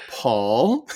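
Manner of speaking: drawn-out accusing Paul with a rising end; suspicious. Retold or imitated. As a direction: call him out knowingly. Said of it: "And I go".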